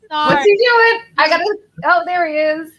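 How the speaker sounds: high, loud excited squeal; excited pet voice. Real, not acted. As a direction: spot the pet, high and eager. Sudden excitement seeing the pet.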